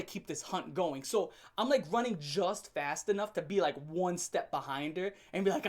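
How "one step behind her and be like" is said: usual speaking voice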